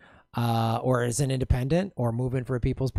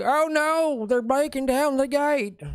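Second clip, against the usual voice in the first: high, slow mock panic; mock panicked woman; 13 semitones higher, slower than usual. Comedian voices the woman fleeing her gated home in mock panic. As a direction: squeal it like a startled lady.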